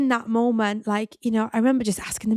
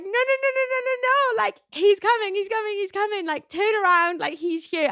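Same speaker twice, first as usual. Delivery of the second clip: much higher, frantic rush of no's; panicked pleading; 11 semitones higher than usual. Retold or imitated. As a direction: replay the panic as the baby crowns, pleading. Panicked at baby arriving.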